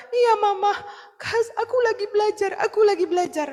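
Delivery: put-on a small kid answering gently; calmer child. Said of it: "dia bilang". (suara anak kecil yang sudah tenang)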